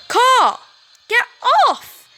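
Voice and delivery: high loud yell swatting birds; frantic shout. Acted. Jocelyn yells at the crows, frantic. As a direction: shriek them away.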